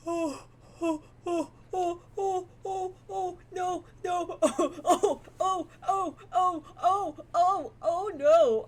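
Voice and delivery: high, loud, whimpering run of frightened oh's; panicked whimpering. Real, not acted. Player spooked by something creepy on screen, panicked whimpering. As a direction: spiral into high terrified whimpers, half-laughing.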